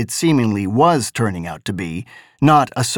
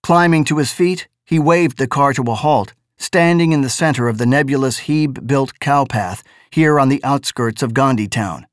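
usual speaking voice

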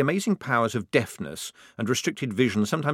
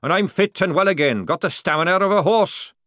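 loud, raised sergeant pleading his case; protesting; 10 semitones higher, 7 dB louder than usual. A narrator's reading. Sergeant arguing to stay in the army, protesting loudly. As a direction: insist forcefully that you are still useful. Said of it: "Jackram protested".

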